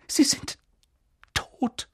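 halting, voiceless delivery of terrible news; faltering grief. Acted. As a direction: let the voice fail before the last word. Breaking news of the deaths. (stockend, fast tonlos)

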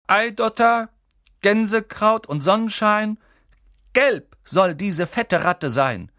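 high, loud, slow spell-casting; incantation. A narrator's reading. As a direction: declaim the spell grandly, drawing it out. Ron recites a spell.

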